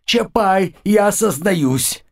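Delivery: high, slow pained yell. Acted. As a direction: howl the confession in pain. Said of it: "тот заорал на весь двор".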